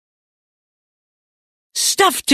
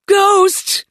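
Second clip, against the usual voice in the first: high-pitched shriek of alarm; sudden, piercing cry of fright; startled yelp; 4 dB louder than usual. A narrator's reading. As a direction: yelp it as if jolted awake. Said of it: "Judy yelped".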